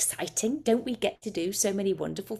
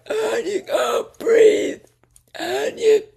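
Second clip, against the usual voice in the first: much higher, slow choked crying; acted breathless panic; 6 dB louder, much slower than usual. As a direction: struggle for air as if dying. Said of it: "I do all the noises".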